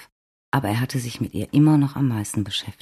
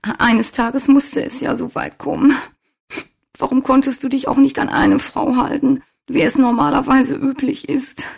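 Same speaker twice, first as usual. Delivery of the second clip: loud wailing in a high, tearful voice; sobbing; 13 semitones higher, 5 dB louder than usual. A narrator's reading. Svenja alone in her car reproaching Wolfgang, sobbing. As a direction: sob it out as an anguished reproach. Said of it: "schluchzte sie".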